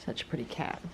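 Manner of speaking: breathy whisper to the cat. Real, not acted. Owner whispering praise to her pretty cat.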